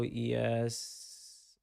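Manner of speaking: ordinary delivery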